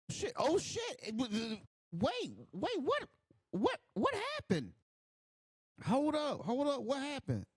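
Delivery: high, stunned disbelief; real shock, stammering to catch up; shocked surprise. Real, not acted. Sudden shock at the match.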